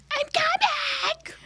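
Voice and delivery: very high, stretched-out mock holler, kept soft; imitating a distant yell. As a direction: call back from far down the hall without real volume. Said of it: "I'm still getting yelled at by her".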